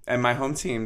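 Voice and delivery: ordinary delivery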